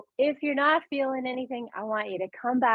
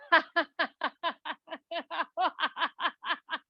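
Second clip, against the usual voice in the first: high, rhythmic released laugh; 6 semitones higher, 4 dB quieter than usual. Shown as a demonstration. Teacher releasing held laughter, long laugh-out. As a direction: burst into long rolling laughter. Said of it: "Laugh it out".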